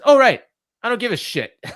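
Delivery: high, loud, frantic imitation of MJF; mock heel champ. Imitating MJF cutting off Brody's music, high and loud. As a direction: squeal it like a bratty champ. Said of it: "he says".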